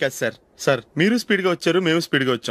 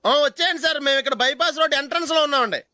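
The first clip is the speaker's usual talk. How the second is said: eager phone report; 7 semitones higher than usual. Acted. Fake polite police phone call.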